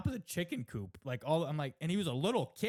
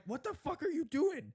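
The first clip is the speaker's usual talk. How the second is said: high-pitched mock yell at a cat; retold yell; 13 semitones higher than usual. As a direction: act out yelling at the cat, pitch jumping way up. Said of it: "I'm, like, yelling at him".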